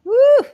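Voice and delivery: high, loud "woo"; short, high-pitched cheer; excited whoop. Real, not acted. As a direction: whoop loudly in approval. Host cheers the guest's answer.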